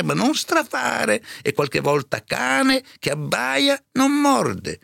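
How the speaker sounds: very high, slow servant voice; rally him with drawn-out proverbs; encouraging housekeeper. A narrator's reading. Serva rincuora il padrone spaventato. (serva che rincuora, voce molto acuta)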